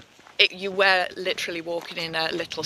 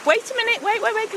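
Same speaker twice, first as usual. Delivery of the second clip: urgent; 13 semitones higher, much faster than usual. Real, not acted. Dog pulling her.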